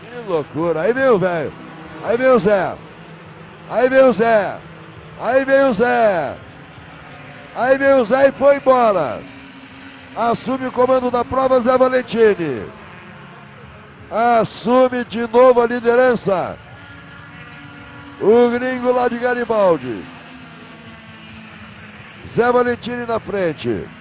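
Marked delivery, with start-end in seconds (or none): 0.8-9.2 rising, louder with each call; excited build-up. Real, not acted. Commentator building to lead change. 10.1-23.9 excited race call. Real, not acted. Calling the new race leader.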